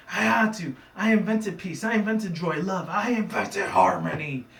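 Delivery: high, loud-ish theatrical tirade; hammed-up rant, stretching the last line; evil villain outburst. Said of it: "started becoming this evil chick".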